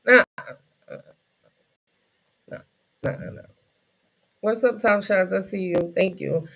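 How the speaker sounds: ordinary talk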